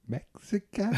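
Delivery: Mexico? asked up high and tentative; unsure high question. Retold or imitated. Fumbling for the right word with friend, high unsure question. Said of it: "I was like".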